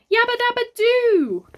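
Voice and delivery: high, loud pained yelp. A narrator's reading. Father yelps as the creature bites him. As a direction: yelp it out as if bitten. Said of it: "yelped Father".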